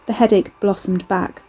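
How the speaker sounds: usual speaking voice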